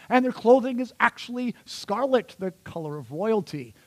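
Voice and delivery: high, emphatic, swooping proclamation; hyperbolic superwoman reading. Shown as a demonstration. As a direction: list her virtues like a superhero's powers.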